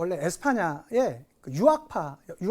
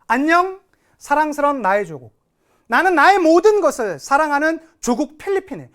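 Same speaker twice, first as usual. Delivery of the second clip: raised, declamatory farewell reading; impassioned poem recitation; 5 semitones higher, 6 dB louder than usual. Acted. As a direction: recite a patriot's farewell, loud and lifted. Reads the farewell poem aloud.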